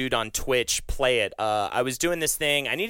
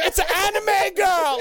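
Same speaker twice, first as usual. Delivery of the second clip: mock fan scream; 4 dB louder than usual. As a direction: scream it like you're losing your mind at a concert. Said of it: "just screaming like".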